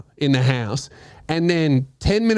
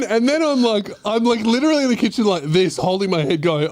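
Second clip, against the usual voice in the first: usual speaking voice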